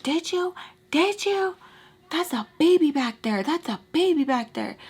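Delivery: high, heated retold yelling; retold angry yelling. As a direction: yell at the driver while hitting him. She hits him, yelling.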